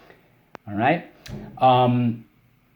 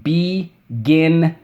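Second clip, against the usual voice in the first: "Beginner" slow and loud, be, gin, NER; syllable by syllable; 5 semitones higher, 5 dB louder, much slower than usual. Shown as a demonstration. Teacher cues class on spelling word, loud syllable by syllable. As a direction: break "Beginner" into three drawn-out, forceful beats. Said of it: "Beginner, let's go".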